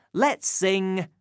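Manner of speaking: ordinary talk, nothing marked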